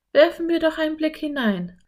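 put-on girl voice, keen to go in; eager Anne. A narrator's reading. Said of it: "schlug Anne vor".

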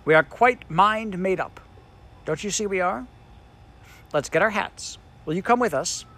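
put-on high, bright girl insisting; a keen child hurrying; eager Jane. A narrator's reading.

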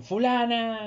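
drawn-out name call; lightly hollered, long-held name; mock calling out. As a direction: summon a neighbour, stretching the vowels. Acted neighbour calling someone.

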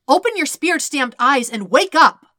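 harsh yelled command; harsh shout. Acted. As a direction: yell him awake, harsh as blades. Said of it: "The last bit is a shout".

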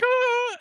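short mock squeal, very high; high-pitched startled "ah"; imitated high squeal. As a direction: squeal as if caught out. Girl's reaction before hanging up, imitated.

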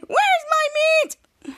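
high, slow, whiny sad voice; mock sad. Retelling Mika's lost meat, high slow mock sadness. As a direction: drag it out like a sulking kid. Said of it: "And Mika said sadly".

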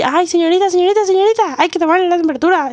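high, fast calling guard; calling after someone, urgent. Retold or imitated. Guard calling her back.

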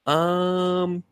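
high, uneasy no; awkward refusal. Retold or imitated. Viewer recoils from the creepy brother, high and uneasy. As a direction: squirm out of it politely. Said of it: "you're like".

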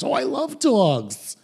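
mock gushing, pitched high and slow; cheery Richard Simmons. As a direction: gush like an overjoyed fitness guru. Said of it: "as happy as Richard Simmons".

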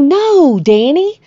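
high, loud protest; protesting refusal. Retold or imitated. Woman refuses Danny's money, protesting. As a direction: imitate an emphatic, pleading refusal.

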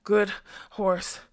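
lightly panted, broken between words; winded man catching breath mid-phrase; a touch of breathlessness; panting. A narrator's reading. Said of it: "the fat man panted".

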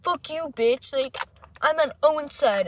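mock hype-man jeer; imitated taunting friend. Friend imitating him siding with her boyfriend, mock jeering. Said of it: "he's like".

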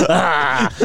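high laugh breaking into "ah"; laughing cry. Real, not acted. Podcaster cracking up at the co-host, high laughing outburst. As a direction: burst out laughing, then cry out.

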